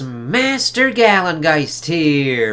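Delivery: high, loud showman host voice; announcer intro. Acted. Host greeting viewers in a high, loud showman voice. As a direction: hype up the review opening like a showman.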